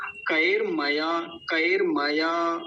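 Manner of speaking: "कएर मया" very slow, r without a vowel; careful pronunciation. Shown as a demonstration. Teacher asks for attention, slow syllable demonstration. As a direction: stretch "कएर मया" as ka-er ma-yaa. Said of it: "देखिए ध्यान से".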